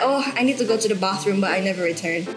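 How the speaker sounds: ordinary talk, nothing marked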